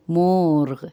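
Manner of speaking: مورغ as one long syllable, "moorgh", no final ee; Persian pronunciation. Shown as a demonstration. Teacher contrasts it with Urdu murghi, Persian pronunciation. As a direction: stretch مورغ and stop on the gh. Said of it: "فارسی میں کہتے ہیں".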